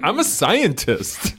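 slow, loud, high Wahlberg line; Mark Wahlberg impression. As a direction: drag it out like Wahlberg. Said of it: "He just said it so much".

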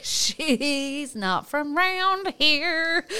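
high, very slow, stretched-out delight; gleeful drawl. Retold or imitated. Tells of first hearing the coworker's accent, gleeful high drawl. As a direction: stretch it out high, hugely tickled.

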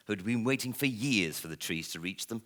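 ordinary delivery

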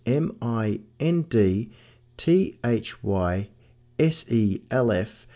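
slow, letter by letter; slow dictation of a web address; spelling out slowly. Real, not acted. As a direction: spell it out with pauses between groups.

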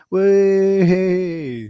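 loud, high, long whoop; drawn-out cheer. Real, not acted. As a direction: stretch it into a big cheer. Cheers film coming on.

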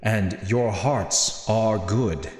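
slow, measured, solemn pronouncement; unhurried character voice with weighty pauses; Natius. Acted. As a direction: speak slowly and deliberately, like a grave quest-giver. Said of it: "He looks back at you and says".

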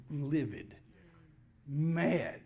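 ordinary delivery

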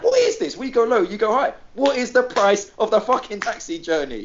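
high-pitched mock outrage; retold exasperation. Passengers confronting driver over a shifting fare, exasperated.

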